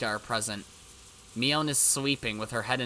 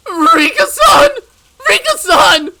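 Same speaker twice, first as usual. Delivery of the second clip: high, loud sobbing shout; wailing the name, much higher and louder; anguished cry; 16 dB louder than usual. Acted. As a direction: scream her name in grief. Mion cries for dead Rika.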